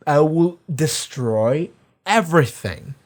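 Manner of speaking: slow, deliberate threat; retold menace, slow and weighty; villain threat. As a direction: stretch out each word of the threat. Voicing Homelander's threat.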